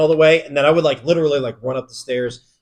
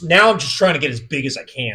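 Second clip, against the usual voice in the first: normal talking voice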